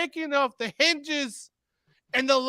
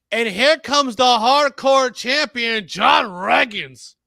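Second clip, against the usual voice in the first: big, slow ring-announcer entrance call; stretched-out name for the crowd; champion introduction; 2 semitones lower, 6 dB louder, slower than usual. Pretend. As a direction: announce the champion loud and drawn out.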